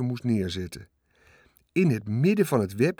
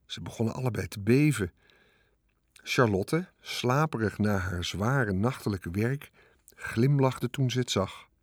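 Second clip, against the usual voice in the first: ordinary delivery, nothing marked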